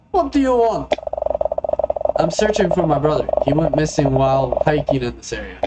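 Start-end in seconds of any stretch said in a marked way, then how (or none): 0.1-0.9 higher-pitched put-on Russian accent. Gas station customer to a searching stranger, Russian accent. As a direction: play a curt stranger with a light Russian accent. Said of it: "I don't know why I made him Russian".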